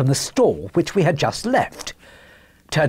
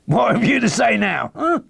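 brash market trader's voice; brash salesman; 8 semitones higher than usual. Acted. Salesman triumphantly challenges his customer, brash.

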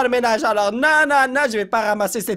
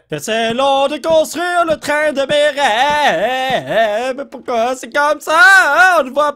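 whiny drawn-out lament; 4 semitones higher, 5 dB louder, much slower than usual. Acted. Whining about slow building.

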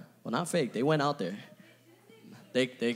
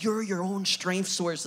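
slow, higher, lightly mocking motivational speaker; mocking TED talk voice; 5 semitones higher, slower than usual. Preacher mocking a TED talk speaker with a slow, higher voice. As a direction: parody a smug TED talk line. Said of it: "It's just like".

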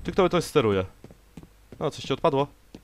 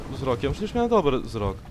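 ordinary talk

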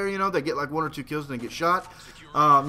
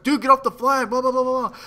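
telling off; 7 semitones higher, 4 dB louder than usual. Retold or imitated. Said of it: "telling him".

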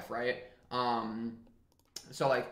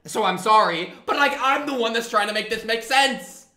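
very loud, raised-pitch protest; mad outburst; 6 semitones higher, 9 dB louder than usual. Real, not acted. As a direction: blow up in exasperated self-defence. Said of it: "I needed to get mad at this".

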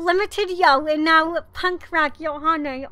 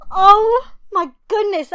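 1 excited cry; 4 semitones higher, 6 dB louder than usual. Real, not acted. Surprise pull.

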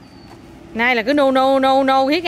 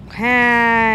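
"hai" drawn out long; counting demo. Shown as a demonstration. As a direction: stretch "hai" out, leaving room for a child to count on. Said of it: "con phải đếm một". (đếm mẫu, kéo dài "hai")

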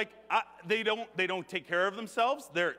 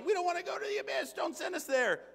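higher, fast, frantic demon voices; pleading demons; 9 semitones higher, faster than usual. Acted. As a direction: beg in a rushed, high voice. Demons begging Jesus.

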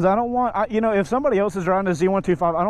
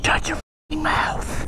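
whispering; 8 semitones higher, much slower than usual. Real, not acted. Annoyed hushed reply.

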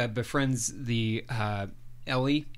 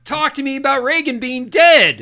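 loud, high, yelling boss; boss shouting; 12 semitones higher, 10 dB louder than usual. Retold or imitated. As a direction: yell it like a furious boss at the end of her rope. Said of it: "her boss is saying".

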